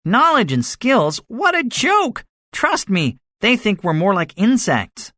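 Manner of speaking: high-pitched mocking echo; scornful. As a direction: scoff at the idea, pitch soaring. Gunner scoffs at narrator's hope.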